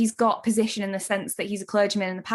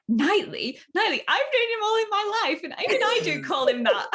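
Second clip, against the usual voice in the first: laughing storm-off; 11 semitones higher than usual. Retold or imitated. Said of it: "she's storming off".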